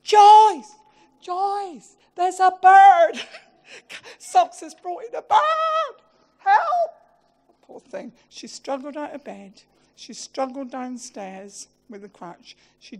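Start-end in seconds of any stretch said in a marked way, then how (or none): 0.0-6.9 high, loud panicked yelling; panicked screaming. Retold or imitated. Husband retelling screaming for his wife about the pigeon, high and loud. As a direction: scream upstairs for help, half laughing. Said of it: "And I'm screaming".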